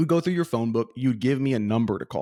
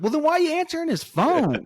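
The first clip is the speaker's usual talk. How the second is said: incredulous, pitched way up high; mock incredulous question. Prank caller challenging the man who picked up, high-pitched incredulity. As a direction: squeal the question in mock disbelief.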